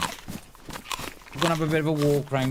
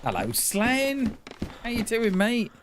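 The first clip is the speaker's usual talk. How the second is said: put-on greeting; 10 semitones higher, slower than usual.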